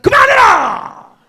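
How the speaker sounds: roaring at the top of his voice, high and loud, slowly; father's furious shout. Retold or imitated. Old father shouting at his son to stop dredging up the past, a furious roar. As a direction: thunder it out like an old man springing to his feet. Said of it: "팔십 노인네가 그러는 거야".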